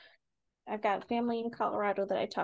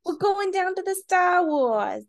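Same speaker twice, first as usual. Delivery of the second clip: slow, higher, loud Boston voice; Boston accent; 7 semitones higher, 5 dB louder, slower than usual. Pretend. Trying New York but landing on a Boston accent. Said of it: "That's still Boston".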